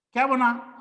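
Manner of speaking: ordinary talk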